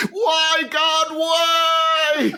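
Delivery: high, wailing mock-grief shout; anguished cry. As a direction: cry out to the heavens. Mock grief over fallen comrade.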